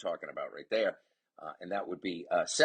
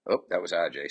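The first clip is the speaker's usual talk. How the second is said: ordinary talk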